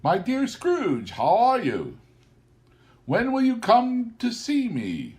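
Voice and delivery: put-on high, lilting hello to an old friend; sung-out cheery street greeting; cheerful greeting. A narrator's reading. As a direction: greet him gladly, as a friendly passerby. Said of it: "with gladsome look".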